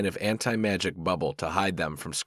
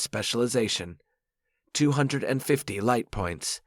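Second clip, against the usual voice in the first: raised, formal game-system announcement; system notification; 5 semitones higher than usual. A narrator's reading. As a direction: read it like a game interface voice.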